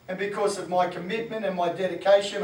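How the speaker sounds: usual speaking voice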